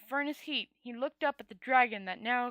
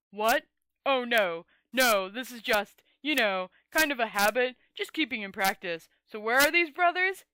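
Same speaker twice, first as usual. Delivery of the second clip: loud; 7 dB louder than usual. Acted. Hearn cheerful after fight.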